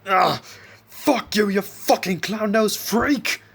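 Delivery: high, loud strained yell; pained furious shout. A narrator's reading. Isaac nailed down curses his captor, pained shouting. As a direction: groan in pain, then scream the insult. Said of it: "growled in pain as he shouted".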